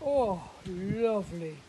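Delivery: slow, loud pleased giant. Acted. Giant delighted with his dessert, booming. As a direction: savour it like a greedy giant. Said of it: "said the giant".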